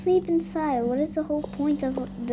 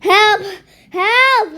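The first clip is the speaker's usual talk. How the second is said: loud, high cries for help; frantic calls for help; shouting for help; 7 semitones higher, 10 dB louder than usual. Acted. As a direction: yell for rescue. Burning mouth, calling for help.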